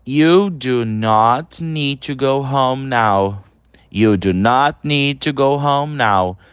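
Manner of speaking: slow, clear teacher read of an English example; slow model sentence. Shown as a demonstration. Teacher reading the second example to students, slow and clear. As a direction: read it slowly so students can repeat. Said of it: "Olha aí o segundo exemplo".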